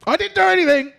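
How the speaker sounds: high loud protest; indignant yelp. Real, not acted. Protesting to the reader that he did nothing, mock indignant yelp. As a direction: cry out in mock innocence.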